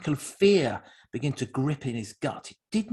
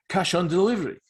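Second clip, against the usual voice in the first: higher, louder incredulous repeat; mocking echo; 5 semitones higher, 5 dB louder than usual. A narrator's reading. Echoing the cash demand to mock it, incredulous. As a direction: throw the demand back in mockery.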